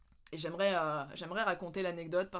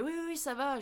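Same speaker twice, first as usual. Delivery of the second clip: hurried reassurance; 7 semitones higher than usual. Retold or imitated. Brushing off worried driver while upset.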